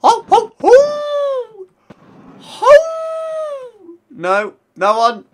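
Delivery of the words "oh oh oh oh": very high, loud howling Oh; playful wolf howl. Pretend. Repeats the wolf call.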